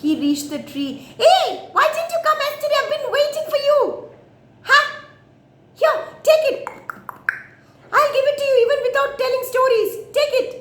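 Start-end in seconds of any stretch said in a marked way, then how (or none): 1.2-10.5 very high, loud, fast monkey voice; squeaky, eager monkey rattling along; excited monkey. Acted. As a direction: call out impatiently to a late friend.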